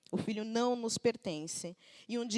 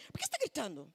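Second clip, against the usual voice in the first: lightly voiced mom's scolding question, softer; a hint of a fed-up mother asking; 5 dB quieter, faster than usual. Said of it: "a minha atitude é".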